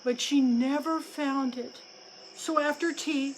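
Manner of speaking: usual speaking voice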